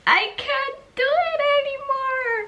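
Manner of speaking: moaning she's done; whiny giving up. Retold or imitated. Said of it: "I kept saying".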